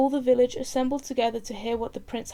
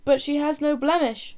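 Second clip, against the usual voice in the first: higher, louder shout from the crowd; 5 semitones higher, 7 dB louder than usual. Acted. Another villager protesting to the court, shouting. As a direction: shout your protest across the clearing. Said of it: "shouted another".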